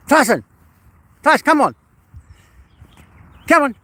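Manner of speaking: loud, high-pitched call to a dog. Real, not acted. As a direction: call out sharply to your dog. Calling dog on a walk.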